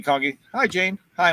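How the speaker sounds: usual speaking voice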